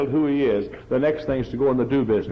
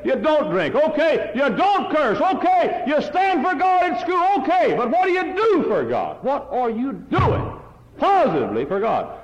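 preaching at full force; 11 semitones higher than usual. Acted. Preacher rebukes the congregation, high and ringing. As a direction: drive each okay upward.

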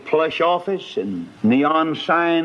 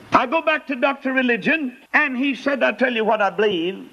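excited high pitch; 12 semitones higher than usual. Acted. Excited return to Doctor Religion.

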